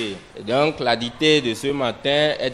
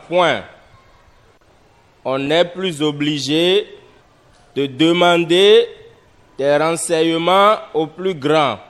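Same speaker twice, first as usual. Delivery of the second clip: dictation; 3 semitones higher, 4 dB louder than usual. Shown as a demonstration. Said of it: "nous allons passer à l'écriture de la dictée".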